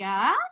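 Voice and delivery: "Ah?" with a rising question tune; a baby's babble asking a question; "Ah?" rising at the end like a question; questioning baby sound. Shown as a demonstration. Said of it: "almost like a question".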